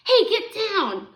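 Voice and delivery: high, loud and drawn-out order; alarmed shout. A narrator's reading. Harvey to cat climbing on the pan, alarmed shout. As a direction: call out sharply to shoo the cat.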